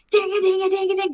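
high, sing-song ding noises mimicking the spoon; imitated tea clinking. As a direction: chime it out like a teaspoon on a mug. Kettle and spoon noises at 3am.

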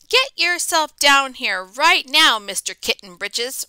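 a hint of a loud scolding mother; ordering mother. Mother ordering her kitten downstairs, mildly stern. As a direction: call him down like a cross mum. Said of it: "ordered Mama June Bug Jones".

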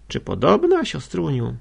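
loud, disbelieving exclamation falling on "siostruniu"; incredulous protest. A narrator's reading. Brother objects to sharing rooms, incredulous. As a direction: pitch leaps high on "podobna". Brother shocked at sister's plan.

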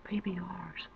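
dreaded hushed whisper. Real, not acted. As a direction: breathe it out in dread. Dreading the PBR.

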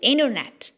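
"internet" with the first T dropped; American INTERNET. Shown as a demonstration. Teacher repeating American "internet", silent-T pronunciation. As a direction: say "internet" as "innernet". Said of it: "مش موجودة".

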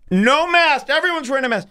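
ordinary talk

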